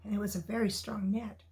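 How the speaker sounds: ordinary delivery, nothing marked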